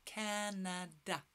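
"Canada" slowly, split as Ca-na-da; syllable by syllable. Shown as a demonstration. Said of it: "Can you say Canada?".

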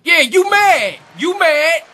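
high, loud gloating taunt. Real, not acted. Player taunting a rival mid-game, loud taunt.

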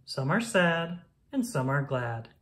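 ordinary delivery

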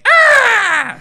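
very high, loud shrieking screech; pterodactyl screech. Retold or imitated. Great-grandmother flying off as a pterodactyl, loud high screech. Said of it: "she's like".

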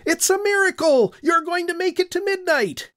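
imagined doctor announcing a miracle, high and jubilant; overjoyed doctor. Pretend. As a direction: burst out with the doctor's amazed joy. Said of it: "if he walked in and said".

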